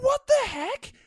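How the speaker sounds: high, loud burst of disbelief; shocked reaction. Retold or imitated. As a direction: yelp it in mock shock. Retelling baffled trailer reaction.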